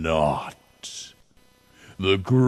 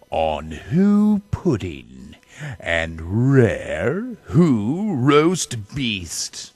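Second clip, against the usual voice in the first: ordinary delivery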